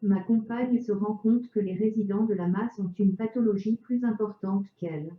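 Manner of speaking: chipper text to speech voice; synthetic voice. Real, not acted. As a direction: speak like a computer speech synthesizer. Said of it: "avec une voix de synthèse".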